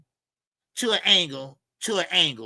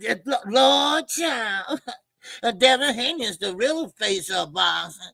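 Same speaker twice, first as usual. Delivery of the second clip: diva mode; 3 semitones higher than usual. Retold or imitated. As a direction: gush like a dramatic diva. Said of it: "go to their enemy and say".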